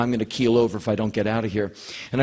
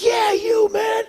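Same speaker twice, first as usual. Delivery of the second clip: much higher, loud excited shout; excited call; 3 dB louder, much slower than usual. Retold or imitated. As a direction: call out to him, thrilled. Calling out to confused Paul.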